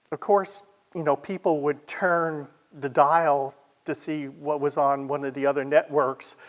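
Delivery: ordinary talk, nothing marked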